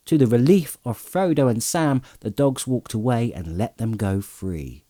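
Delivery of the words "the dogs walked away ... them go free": plain reading voice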